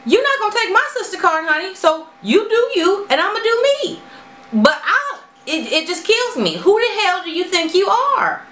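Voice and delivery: high, fast, defiant comeback; defiant sass. Real, not acted. Telling critics they can't take her sister card, defiant. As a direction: push back hard and high.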